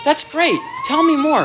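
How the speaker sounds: light enthusiastic encouragement; simple warm interest. Shown as a demonstration. Parent simply encouraging the child, high and eager. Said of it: "The phrase says it enough".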